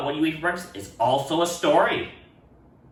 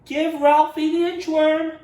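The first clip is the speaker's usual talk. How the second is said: high, louder sing-song; pleading with baby; 5 dB louder than usual. Acted. Ralph asking the baby for Nick, high pleading. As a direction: plead sweetly.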